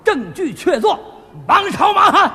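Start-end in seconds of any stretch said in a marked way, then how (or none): 1.5-2.3 loud, high judge's call; commanding summons. Acted. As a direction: summon the guards across the court. Judge summons his guards.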